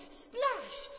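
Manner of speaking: loud high splash. A narrator's reading. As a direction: make the splash land. She falls into water.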